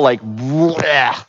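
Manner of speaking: gag it out from the throat; guttural bleh. Shown as a demonstration. Preacher picturing vomiting up depravity, guttural retch. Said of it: "this kind of guttural".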